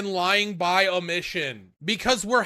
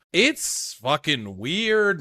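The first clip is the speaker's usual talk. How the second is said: ordinary talk, nothing marked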